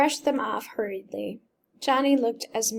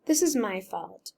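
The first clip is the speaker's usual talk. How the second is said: plain reading voice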